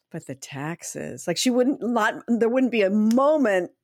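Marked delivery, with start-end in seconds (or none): 0.1-1.3 soft, slow, low deflating mutter; gloomy mother-in-law. Retold or imitated. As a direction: drag it down flatly. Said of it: "That would be her first".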